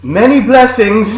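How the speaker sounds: slow, high Renaissance farewell; playful olde-English send-off; mock fair blessing.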